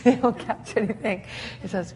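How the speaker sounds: ordinary delivery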